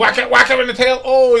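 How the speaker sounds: fast, loud, egging on; excited shout. Real, not acted. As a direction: cheer the dog on quickly. Excited cheering at the dog.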